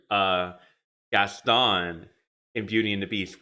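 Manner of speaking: normal talking voice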